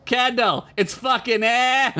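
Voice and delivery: Boston man calling to his girlfriend, mock yell. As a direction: holler it across the dock in a Boston accent. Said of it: "And he yells".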